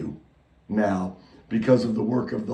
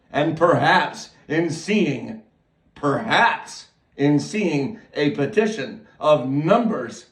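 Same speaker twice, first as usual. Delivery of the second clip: full-force preaching; 4 semitones higher than usual. Acted. Preacher urges the sinner to beg for mercy, fervent. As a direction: rouse the flock to go beg.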